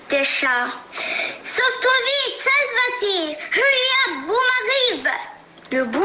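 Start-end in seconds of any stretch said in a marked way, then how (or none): 1.4-5.1 shouting. Acted. Fleeing animals shout warning.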